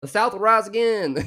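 mock Southern yokel. Mocking Southern cookbook charm.

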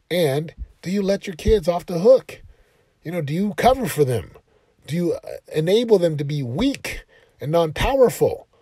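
preacher's challenge; challenging question. Real, not acted. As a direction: call the parents out. Challenging parents directly.

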